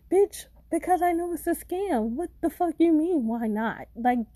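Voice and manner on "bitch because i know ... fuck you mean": exasperated yell. Real, not acted. Yelling at scammers.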